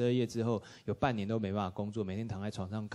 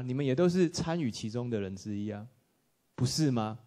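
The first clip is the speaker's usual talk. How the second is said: normal talking voice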